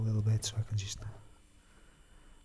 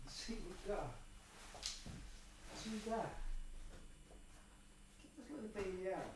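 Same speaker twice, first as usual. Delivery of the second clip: muttering to himself; 6 semitones higher, 17 dB quieter, faster than usual. Real, not acted. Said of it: "muttering while fetching guitar".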